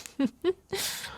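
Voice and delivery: short high giggle; bashful high laugh. Acted. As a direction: giggle shyly at him. Laughs after loving remark.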